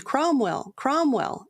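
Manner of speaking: high ringing call of the name; crowd cry. Acted. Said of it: "is the cry".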